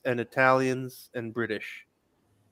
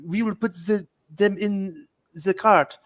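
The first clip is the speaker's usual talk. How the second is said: mock French accent, higher pitch, last words drawn out; farcical French accent; 5 semitones higher, slower than usual. French resistance lady explaining the plan, hammed-up French accent. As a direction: put on an exaggerated French accent. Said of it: "exaggerated farcical accent".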